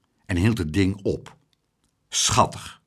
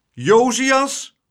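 much higher, louder drawn-out call; 9 dB louder than usual. Acted. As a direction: call his name sharply across the lift. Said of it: "riep juffrouw Klaterhoen".

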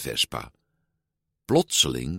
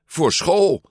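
high, louder burst of urgency; sudden urgent realisation; 10 semitones higher, 5 dB louder than usual. A narrator's reading. Giulia suddenly remembers she must pack, blurting it out urgently.

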